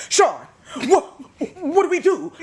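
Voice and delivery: high, loud frantic mom voice; panicked mom. Retold or imitated. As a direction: imitate mom freaking out over a mouse. Mom panicking over mouse.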